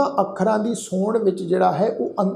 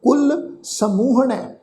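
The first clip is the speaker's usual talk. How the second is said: slow, drawn-out sacred recitation; scripture recitation; 3 semitones higher, much slower than usual. Shown as a demonstration. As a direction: recite slowly, holding the long vowels. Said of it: "ਪਾਠ ਉਚਾਰਨ ਕੀਤਾ ਹੈ".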